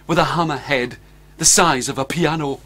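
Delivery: usual speaking voice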